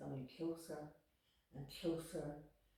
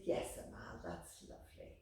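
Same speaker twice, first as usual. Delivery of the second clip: distracted murmur; 11 semitones lower, 6 dB quieter than usual. Mother answering absently.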